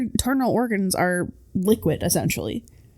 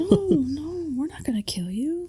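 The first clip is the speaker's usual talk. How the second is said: mock whisper; 8 semitones higher than usual. Voicing a captor from the movie in a whisper. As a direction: hush it like a creepy captor.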